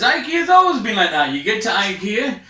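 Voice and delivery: ordinary talk, nothing marked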